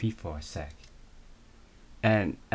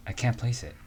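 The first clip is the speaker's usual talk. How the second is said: normal talking voice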